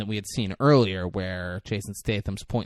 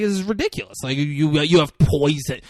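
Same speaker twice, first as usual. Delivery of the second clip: angry rant; 5 semitones higher, 9 dB louder than usual. Retold or imitated. Beth ranting at hitman boyfriend.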